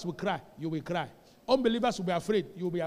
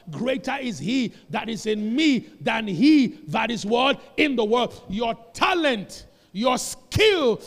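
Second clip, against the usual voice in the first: louder, slow, pressing each word; emphatic preaching; 2 semitones higher, 8 dB louder, slower than usual. Real, not acted. Preacher leading the congregation through the verse, loud. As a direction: drive the verse home.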